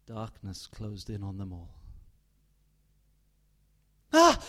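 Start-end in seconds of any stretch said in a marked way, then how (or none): 4.1-4.4 sudden high loud cry of waking fright; startled gasp-cry. Acted. Mother waking by the lake with a startled cry. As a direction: jolt awake with a sharp cry.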